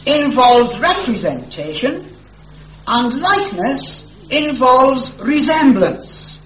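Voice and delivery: loud, rising sermon delivery; full-force preaching. Acted. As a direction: preach it out to the whole hall.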